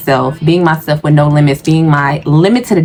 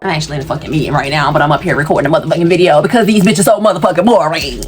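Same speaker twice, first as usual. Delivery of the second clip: hurried aside; 2 semitones lower, much faster than usual. Real, not acted. Rushed aside about the meeting.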